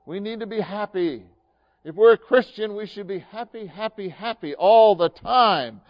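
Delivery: sing-song high mock of happy, happy talk. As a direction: parody the shallow cheerful view.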